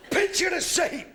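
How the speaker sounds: announcing. Real, not acted. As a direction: call the audience to attention, high and loud. Sets up story for audience.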